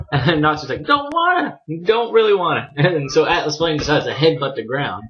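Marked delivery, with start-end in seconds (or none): 0.8-2.7 put-on high childish whine; hammed-up high-pitched protest; mock petulant refusal. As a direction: imitate a stubborn kid refusing. Voicing stubborn refusal in retold story.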